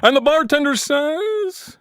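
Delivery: high nasal W C Fields drawl. Acted. W C Fields stumbling in to tell a joke, high drawl. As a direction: ham up an old-time comic drawl. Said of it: "W C Fields".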